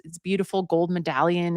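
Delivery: ordinary delivery, nothing marked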